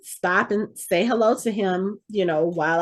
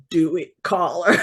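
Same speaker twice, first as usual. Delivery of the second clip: light impression of an actor's TV character, laughing; lightly voiced TV hunter urging her on, chuckling; a hint of Bobby Singer egging her on; 3 semitones lower than usual. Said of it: "He did it in the Bobby Singer voice".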